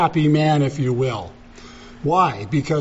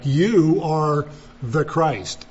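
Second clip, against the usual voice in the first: slow, weighty stress on "you"; "you" drawn out and stressed; emphatic YOU; much slower than usual. Shown as a demonstration. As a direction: stretch "you" out before "are the Christ". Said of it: "that is emphatic".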